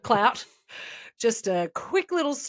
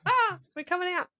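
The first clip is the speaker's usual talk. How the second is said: higher, quick coughing cult member; 9 semitones higher, faster than usual. Retold or imitated. Cult member stumbling out of smoked bunker, hurried and higher. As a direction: spill out of the smoky bunker in a rush.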